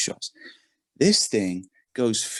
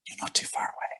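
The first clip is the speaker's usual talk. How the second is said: soft whispered aside, much softer; 9 dB quieter than usual. Retold or imitated. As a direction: whisper it like a secret tip to a stranger. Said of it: "he whispers to me".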